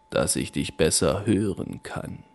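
wolf in granny's bed answering low; wolf as grandmother. Acted. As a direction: purr it back with hidden menace.

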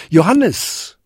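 calling out. A narrator's reading. Father calls down to his son Johannes, calling out. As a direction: call down to someone below. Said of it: "rief er".

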